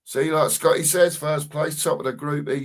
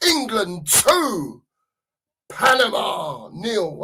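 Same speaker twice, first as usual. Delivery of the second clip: commentator score call; 5 semitones higher, slower than usual. Pretend. Friends watching the game, calling the score in a booming slow commentator voice.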